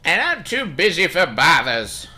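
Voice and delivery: put-on high, loud, put-upon old doctor grumbling; high-pitched cranky old man complaining about his debts; crusty doctor voice. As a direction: play him as a stingy old codger brushing off a visitor.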